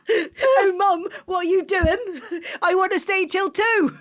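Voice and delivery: put-on high whiny teenager through laughter; teen voice, laughing. Host teasing the guest by mocking her kids. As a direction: mimic a pleading teen while giggling. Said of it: "it's so funny not to reference".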